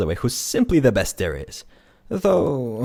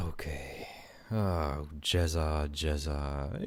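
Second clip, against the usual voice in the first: gentle comfort; 5 semitones lower, 10 dB quieter, much slower than usual. Acted. Comforting the grieving ghost.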